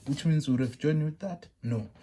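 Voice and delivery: usual speaking voice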